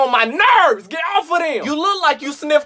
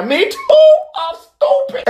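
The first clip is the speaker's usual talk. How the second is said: much higher, louder joke voice; squeaky reply; 4 dB louder, slower than usual. Pretend. Friend answers in a high silly voice. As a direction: pipe it up silly.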